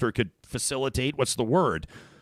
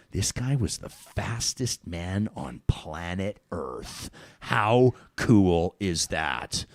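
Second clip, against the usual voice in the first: awestruck inner thought; 4 semitones lower, much slower than usual. Retold or imitated. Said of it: "I'm like".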